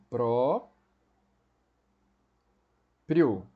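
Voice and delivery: Pró, then pri, o, each syllable set apart by long pauses. Shown as a demonstration. Teacher showing the class how to divide próprio, syllable by syllable. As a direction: break próprio into its syllables slowly and firmly. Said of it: "A gente divide assim".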